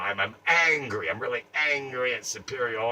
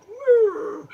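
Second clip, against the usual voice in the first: a sudden, much higher "Woo"; mocking mime woo; 7 dB louder than usual. Mocking the mime's head tilt with a much higher "Woo". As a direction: squeal "Woo" like a swooning mime. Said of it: "and just go".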